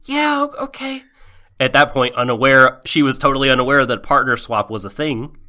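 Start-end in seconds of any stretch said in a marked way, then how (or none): 0.0-1.0 high, hesitant, stammering agreement; nervous stammer. Retold or imitated. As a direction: reluctantly squeak out a yes. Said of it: "basically just like".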